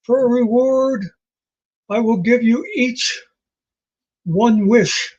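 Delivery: grand, drawn-out genie proclamation. Acted. Genie granting three guys a wish, grand proclamation. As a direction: proclaim it like a genie freed from a bottle. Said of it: "And he's like".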